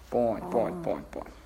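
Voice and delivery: low, very soft little murmurs; hushed, fading reassurance; soothing a pet. Real, not acted. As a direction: settle the animal down quietly.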